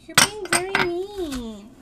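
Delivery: high, loud, drawn-out whine; playful whining. Pretend. Woman complaining to her teasing partner, loud playful whine. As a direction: drag it out like a wronged child.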